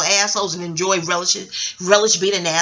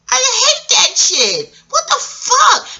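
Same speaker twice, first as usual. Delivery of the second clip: exasperated outburst; 13 semitones higher, 3 dB louder, much slower than usual. Real, not acted. Upset about celebrities being badmouthed.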